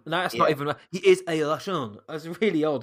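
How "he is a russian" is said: badly faked Russian accent, kind of French. Shown as a demonstration. Podcaster retrying an actor's villain voice, bad Russian accent. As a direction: have another go at a hopeless Russian accent. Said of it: "that was the worst Russian accent".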